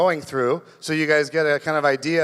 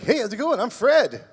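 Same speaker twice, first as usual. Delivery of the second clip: roommate voice; 5 semitones higher than usual. Retold or imitated. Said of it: "And he's like".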